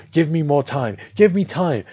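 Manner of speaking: high, loud plea; loud and pitched right up; panicked shout. A narrator's reading. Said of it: "He wanted to shout out".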